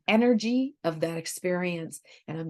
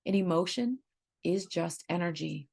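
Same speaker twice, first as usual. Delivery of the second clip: ordinary talk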